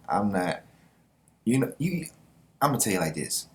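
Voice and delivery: usual speaking voice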